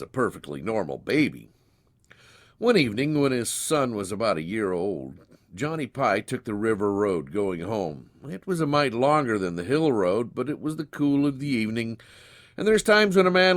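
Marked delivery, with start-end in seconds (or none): none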